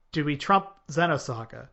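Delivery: usual speaking voice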